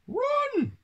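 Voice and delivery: very high, urgent mouse yelp; squeaked. A narrator's reading. Town mouse warning his friend of the cat, high squeak. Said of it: "squeaked Toby".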